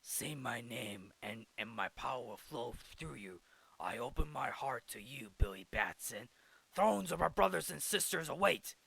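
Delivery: wizard voice. Retold or imitated. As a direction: intone it like an ancient wizard. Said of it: "he hears the voice of the wizard saying".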